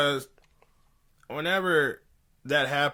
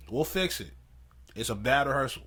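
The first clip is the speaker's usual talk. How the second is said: ordinary delivery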